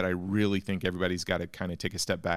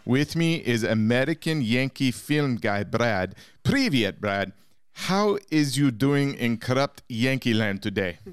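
broken English in a put-on Russian accent; mock Russian accent; 3 semitones higher, 3 dB louder than usual. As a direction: play a cartoon Russian host mocking Yankees.

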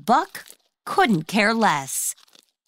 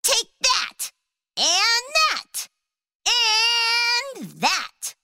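very high battling ant voice; fierce tiny ant; 13 semitones higher, much slower than usual. A narrator's reading. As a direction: cry it like a tiny warrior. Ant biting the deer.

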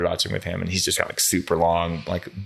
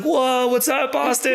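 calling out to new friends, pitched way up; excited greeting; slower than usual. Retold or imitated.

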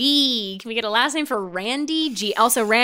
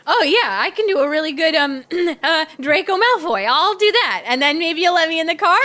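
eager pleading; 6 semitones higher, 3 dB louder than usual. Acted. Alan eager, pitched up.

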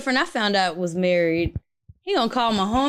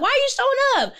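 indignant outburst; faster than usual. Retold or imitated. Confronting boyfriend at friend's house.

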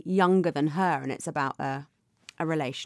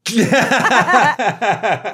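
laugh; 3 semitones higher, 8 dB louder than usual. Real, not acted. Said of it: "I was reading it badly".

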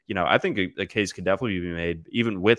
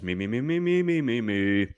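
singsong me-me-me warm-up; vocal warm-up. Shown as a demonstration. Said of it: "Doing some vocal exercises".

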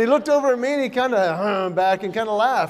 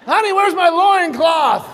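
mock husband calling; 7 semitones higher, 8 dB louder, slower than usual.

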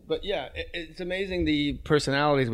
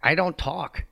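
high dog voice flatly denying; the dog pretends it can't talk; deadpan dog; 5 semitones higher, slower than usual. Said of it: "What, Mabel?".

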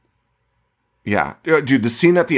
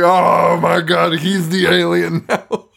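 high, drawn-out fake realization; mock astonishment; 6 semitones higher, slower than usual. Podcaster mocking a doctor convinced by grainy footage, mock astonishment. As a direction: ham up the shock, slow and high.

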